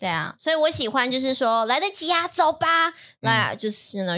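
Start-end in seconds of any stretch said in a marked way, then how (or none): 1.7-2.9 higher-pitched, eager agreement; excited acceptance. Shown as a demonstration. Learner shown how to answer an invitation, excitedly. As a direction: show the excitement, lifting the pitch. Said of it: "去show the excitement".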